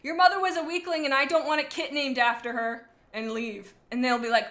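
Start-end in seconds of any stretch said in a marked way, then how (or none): 0.0-2.9 loud, higher-pitched hammed-up outrage; mock scream. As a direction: hurl it like an insult from a car window. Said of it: "stick my head out and scream".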